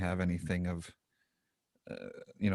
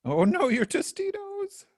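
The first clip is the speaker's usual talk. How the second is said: mock dismay; 6 dB louder, slower than usual. Mock grief over spilled chips.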